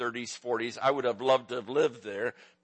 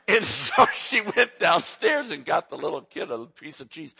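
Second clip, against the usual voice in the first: normal talking voice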